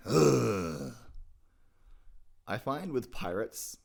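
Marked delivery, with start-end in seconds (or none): 0.1-0.9 bored dirt bike growl. Shown as a demonstration. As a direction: rumble it like a lazy dirt bike. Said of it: "bored dirt bike noise".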